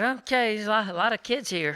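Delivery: grandma startled by the rowdy crowd, nervous; scared old lady. Retold or imitated. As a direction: back away from the crowd nervously. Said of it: "she's all scared".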